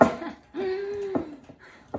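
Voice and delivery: relieved high-pitched laugh; high nervous laugh. Real, not acted. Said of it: "Nervous about that tempering".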